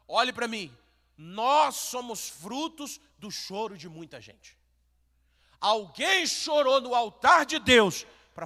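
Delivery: loud, rising sermon build; fiery pulpit preaching; impassioned preaching. Acted. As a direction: preach it out with fire. Preaching at full force.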